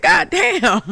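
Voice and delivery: high, loud drawn-out gasp of a curse; very high, loud stunned curse; stunned exclaim. Retold or imitated. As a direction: blurt it out, floored. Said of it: "I said".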